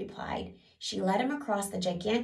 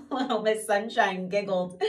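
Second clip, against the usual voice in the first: usual speaking voice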